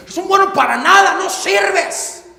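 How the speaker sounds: preacher shouting the insult at the crowd; shouted insult. Retold or imitated. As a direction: hurl the insult like a bully. Acted insult at full force.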